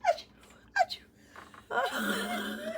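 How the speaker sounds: high, helpless achoos; repeated sneezing. Real, not acted. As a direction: double sneeze, the second high. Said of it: "I can't stop sneezing".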